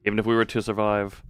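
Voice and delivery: story narration. A narrator's reading. Reads game narration.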